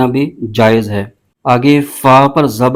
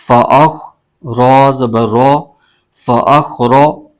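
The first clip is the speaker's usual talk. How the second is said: Quran spelling drill. Shown as a demonstration. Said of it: "اب اس کے ہجے یوں کریں گے".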